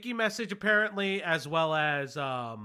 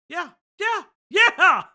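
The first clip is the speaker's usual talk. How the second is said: excited; 10 semitones higher, 9 dB louder, slower than usual. Real, not acted. Excited agreement with co-host.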